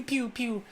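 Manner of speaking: high blaster pew pew sound effect; pew pew noise. Pretend. Vlogger making a blaster noise about Civil War rumors, playful high pew pew. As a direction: zap out a toy laser-gun pew pew. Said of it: "I don't know why I made that noise".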